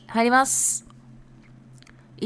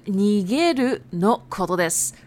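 ordinary talk, nothing marked

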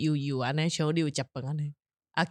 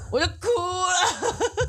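laughing while recalling; 12 semitones higher, much slower than usual. Real, not acted. Recalling bursting into tears.

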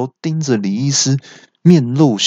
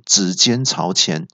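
plain reading voice

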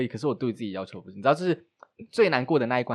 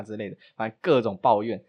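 ordinary talk, nothing marked

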